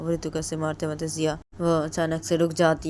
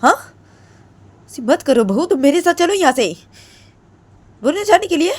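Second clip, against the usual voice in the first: much higher, loud alarmed plea; shrill alarmed mother; 9 semitones higher, 12 dB louder than usual. Retold or imitated. Jiya's mother loudly pleading with bahu to come away. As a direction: cry out in shock at the bahu. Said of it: "बोलती है".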